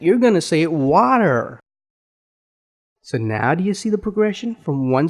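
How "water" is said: "water" in an American accent. Shown as a demonstration. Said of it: "with an American accent".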